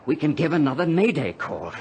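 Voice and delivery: castaway excitedly explaining his rescue plan to his friend; excited realization. Acted. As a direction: burst out with the idea.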